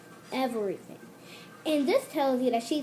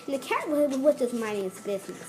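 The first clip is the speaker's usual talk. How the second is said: ordinary talk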